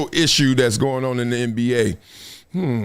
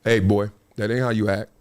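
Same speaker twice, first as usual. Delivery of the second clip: stern dad; 9 semitones lower, 3 dB quieter than usual. Retold or imitated. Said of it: "my dad like".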